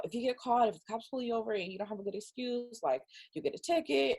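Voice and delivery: lightly voiced parental warning; dad laying down the rules, lightly imitated; serious dad. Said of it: "like, super serious".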